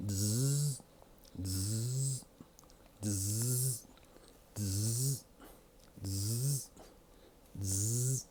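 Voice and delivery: soft buzzing clipper noise through the teeth. Retold or imitated. To viewers, mimicking the stylist's clippers with a soft buzz. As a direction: hum it like an electric trimmer, quietly. Imitating the clippers while waiting.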